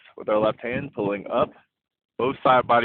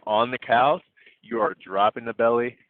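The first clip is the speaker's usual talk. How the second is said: normal talking voice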